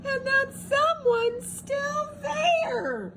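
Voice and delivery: very high, slow frightened squeal; scared Baby Bear shout. A narrator's reading. Baby Bear seeing Goldilocks, high frightened squeal. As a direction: squeal it like a terrified cub. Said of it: "squeaked Baby Bear".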